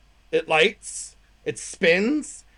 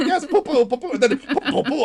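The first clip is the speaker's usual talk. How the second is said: put-on eager baby talk; 6 semitones higher than usual. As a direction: answer eagerly like a delighted child. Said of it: "and I'm like".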